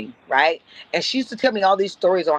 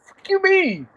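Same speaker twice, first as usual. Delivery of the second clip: indignant retort; 12 semitones higher, slower than usual. Retold or imitated.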